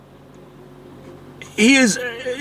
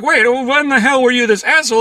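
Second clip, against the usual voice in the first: higher, startled question; mock shocked; 8 semitones higher than usual. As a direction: act blindsided by his temper. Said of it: "and it's like".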